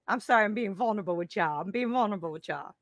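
real tears close, pushing the words out fast; getting emotional. Real, not acted. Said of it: "I didn't think I was gonna get emotional".

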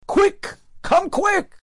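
high, urgent, breathless summons; urgent call. Retold or imitated. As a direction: hurry him along, high and pressing. Student rushing to fetch rabbi.

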